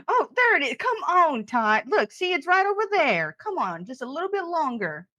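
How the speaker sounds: high sing-song encouragement; high, sugary pet-owner voice; sweet coaxing. Acted. Coaxing the pig down the hall.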